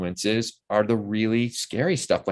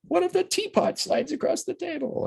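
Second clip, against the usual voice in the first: high-pitched imitation of an eager director; excitedly tossing out what-ifs; excited brainstorming. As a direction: pitch a wild idea way up high. Eager director pitching ideas, contrasted.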